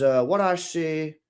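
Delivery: slow, badly faked Irish accent; amateur Irish barman impression. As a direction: stretch it out in a poor Cork accent. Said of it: "It's just a terrible accent".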